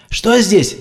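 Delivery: high urgent blurt. A narrator's reading. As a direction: fire off the question breathlessly. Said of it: "выпалил он".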